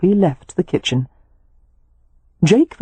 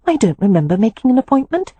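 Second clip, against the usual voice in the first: lightly voiced young wife, fast and higher; a quick, bewildered protest; puzzled Poppy; 7 semitones higher, faster than usual.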